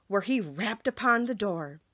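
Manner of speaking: ordinary delivery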